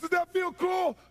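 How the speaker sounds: high, loud taunting scream; unhinged shouted interrogation; crazed yell. Retold or imitated. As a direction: scream the question like a madman. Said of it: "I acted like I was crazy".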